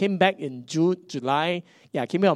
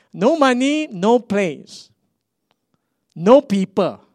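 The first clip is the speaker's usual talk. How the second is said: emphatic list; 7 semitones higher, 8 dB louder, slower than usual. Real, not acted. Listing the struggles, emphatic.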